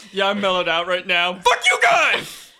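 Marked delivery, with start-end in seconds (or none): 1.3-2.5 high, slow sudden outburst; shouting. Acted. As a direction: snap from calm into a yell. Mellow character suddenly explodes.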